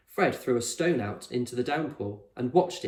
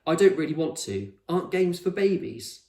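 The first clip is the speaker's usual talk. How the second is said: higher, louder, a bit haughty; defensive Con; 6 semitones higher, 5 dB louder than usual. A narrator's reading. Con dismissing games as babyish, defensive. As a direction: push the idea away. Con voiced in read story.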